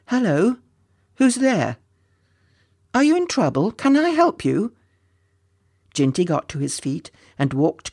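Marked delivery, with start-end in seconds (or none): 0.1-4.7 high, questioning call; concerned high-voiced lad calling. A narrator's reading. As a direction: call out to someone unseen. Ginty calls towards the unseen sobbing.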